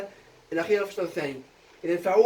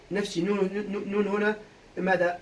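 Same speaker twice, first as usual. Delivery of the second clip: usual speaking voice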